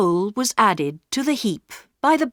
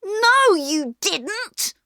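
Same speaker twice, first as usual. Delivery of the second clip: much higher sharp denial; angry boy; 13 semitones higher, much slower than usual. A narrator's reading. Peter to Bobby, angrily denying. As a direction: cut his sister off. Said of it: "said Peter angrily".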